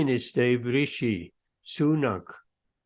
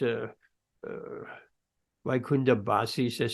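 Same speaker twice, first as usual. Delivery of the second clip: ordinary delivery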